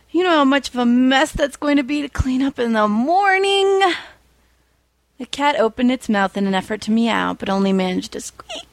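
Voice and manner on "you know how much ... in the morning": a touch higher, mild whine; lightly voiced whining hero. A narrator's reading. Hero mildly whining to the cat about the mess. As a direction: grumble at the cat, a bit high. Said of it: "the hero whined".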